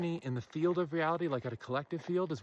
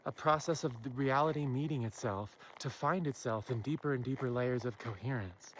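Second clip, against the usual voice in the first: normal talking voice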